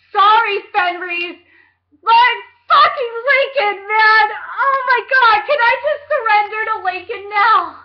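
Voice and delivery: loud, high swooning gush; swooning fangirl. Pretend. Reader swooning over Lincoln's love declaration, loud high gush. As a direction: gush over him like a lovestruck fan.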